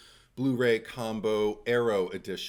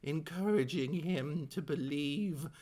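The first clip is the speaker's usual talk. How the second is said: Glenn voice; 9 semitones higher than usual. Acted. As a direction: read the premise as Glenn, building to the title. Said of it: "I could do it as Glenn".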